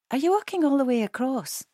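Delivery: high-pitched Edinburgh lady; Edinburgh accent. A narrator's reading. Elderly lady questioning fellow walkers, Edinburgh accent. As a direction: ask with interest in an Edinburgh accent. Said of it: "in an Edinburgh accent".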